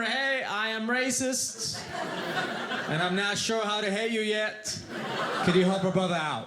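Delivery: lightly voiced Alabama guy. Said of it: "Which is code for".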